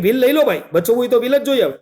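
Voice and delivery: retold shout. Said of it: "મેં પણ બૂમ પાડી".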